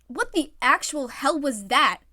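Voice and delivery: loud, slow startled outburst; startled exclaim. Acted. Iwazumi startled by a voice, loud exclaim. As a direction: blurt it, spooked. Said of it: "Iwazumi exclaimed".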